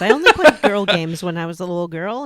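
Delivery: insistent and loud, half joking; loud demand. Pretend. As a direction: demand it playfully, full voice. Playful demand for girl games.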